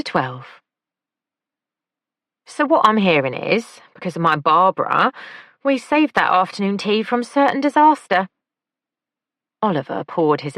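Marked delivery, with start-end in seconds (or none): 2.5-8.3 high, lively camp man; camp male voice. A narrator's reading. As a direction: lilt it up, high and playful. Oliver's voice in audiobook.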